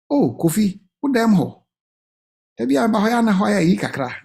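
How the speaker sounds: ordinary talk, nothing marked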